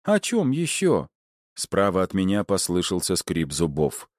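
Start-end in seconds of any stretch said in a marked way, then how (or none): 0.0-1.1 high, drawn-out mocking retort; pointedly high and slow; sarcastic retort. A narrator's reading. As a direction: stretch it out high, as if it were obvious. Meg's sarcastic retort, voiced high.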